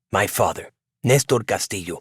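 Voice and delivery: ordinary delivery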